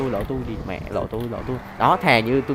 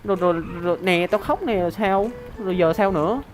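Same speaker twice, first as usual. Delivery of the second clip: mocking taunt; 8 semitones higher than usual. Real, not acted. Streamer jeering at the players who keep shooting him, high mocking taunt. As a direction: goad them, pitch jumped way up and mocking.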